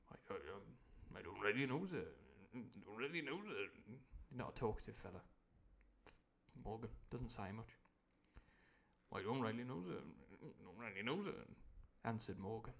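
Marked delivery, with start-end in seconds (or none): 0.1-3.7 timid mumble. Acted. Morgan cowering before Silver. 9.1-11.5 timid mumble. Acted. Morgan cowering, retaken.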